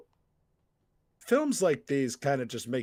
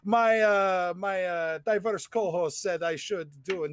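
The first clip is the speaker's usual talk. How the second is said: higher hammed-up French accent, talking along; 7 semitones higher, 3 dB louder than usual. Host explaining the intro, still in a silly French accent. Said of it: "intro in a French accent".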